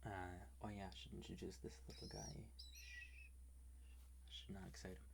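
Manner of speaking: hushed whisper for ASMR. Real, not acted. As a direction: whisper so the roommate sleeps. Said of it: "I'm whispering because".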